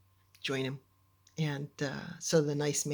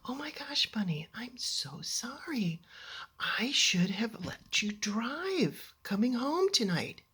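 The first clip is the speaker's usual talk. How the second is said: apologetic dad; 6 semitones higher, slower than usual. Retold or imitated. Dad turning to his daughter in the car, tenderly apologetic. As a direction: apologize gently, drawing it out. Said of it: "and he says to me".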